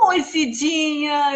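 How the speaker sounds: loud, drawn-out, gushing hello; effusive greeting like Cidinha's. Shown as a demonstration. Host mirroring Cidinha's way of talking, effusive drawn-out greeting. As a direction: stretch it out slowly and loudly, matching her manner. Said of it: "Tem que falar igual a Cidinha".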